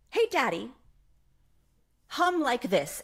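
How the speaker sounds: put-on lightly high, bossy little-girl voice; a hint of a small girl directing Dad; toddler daughter. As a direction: toddler instructing her father in the car, lightly voiced. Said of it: "she says".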